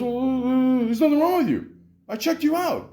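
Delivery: high-pitched mocking imitation; arguing doctor. Doctor insists he checked the patient, defensive. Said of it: "So we're arguing".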